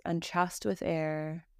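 slow, even meditation voice with long pauses; calm Yoga Nidra guidance, evenly pitched; guided meditation. Acted. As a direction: guide them gently through breath and body, unhurried.

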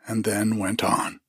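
ordinary delivery, nothing marked

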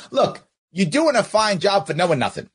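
ordinary delivery

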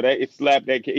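normal talking voice

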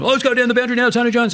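high, bright mock boundary call voice; boundary rider call.

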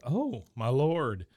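loud, slow, drawn-out gasp of shock. Retold or imitated. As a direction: stretch it out in horror. Retelling Peggy's shock at hair.

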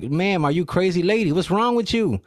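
imitated high, incredulous challenge; mock disbelieving bystander; bystanders' outcry. Acts bystanders confronting the lady.

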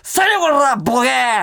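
imitating a gruff old man yelling; angry yell. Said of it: "って怒鳴られたやんか". (怒鳴り声のまね)

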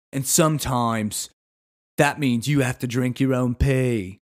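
mock British accent, slow and deliberate; a British accent that drifts between regions; Bear Grylls. Explaining broccoli's defenses, exaggerated drifting British accent. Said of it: "how my accent just devolves".

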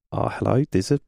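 bad Kiwi accent; mock New Zealand accent. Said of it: "That's a really bad New Zealand Kiwi accent".

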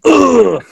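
mock grunt of the wind knocked out. Opponent slammed by a heavy throw, mock winded grunt.

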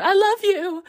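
high, loud mock call of love; Leia calling out. Leia calling out to Han as he's frozen, a high loud mock call. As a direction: cry it out to him like Leia. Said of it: "Leia calls out".

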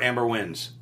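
ordinary talk

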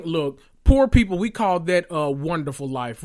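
ordinary talk

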